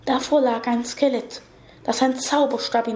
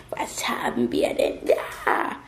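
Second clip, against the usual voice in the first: slow, whispered creature voice; menacing monster whisper; 4 dB quieter, slower than usual. A narrator's reading. Monster spots the necklace on the girl, slow whisper. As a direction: draw it out in a gloating whisper. Monster grins, spotting the stone.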